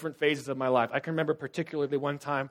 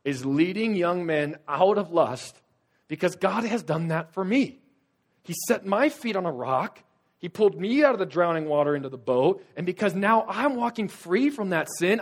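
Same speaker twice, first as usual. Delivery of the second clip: loud, ringing preacher's call; raised pulpit voice, pressing hard; preaching at full force; 6 semitones higher, 7 dB louder than usual. Acted. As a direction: preach it out to the congregation. Fiery preaching at the start.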